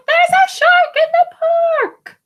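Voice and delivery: put-on high, loud child's yell; shouting warning about a shark; yells with all his might. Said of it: "Timothy yells with all his might".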